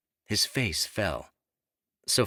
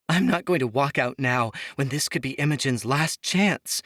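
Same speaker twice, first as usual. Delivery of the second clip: higher and louder, urgent plea; pleading; 7 semitones higher, 4 dB louder than usual. A narrator's reading. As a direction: beg to stay, pressing hard. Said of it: "Sophia pleaded".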